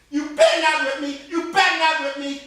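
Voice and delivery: put-on a child talking back; defiant girl. Girl defies missus.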